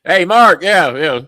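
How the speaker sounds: loud, high, hammed-up announcement; mocking re-enactment. Mocking the tale that he flew down to fire Mark, hammed-up. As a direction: send it up with a laugh breaking in. Mocking the book's version of firing.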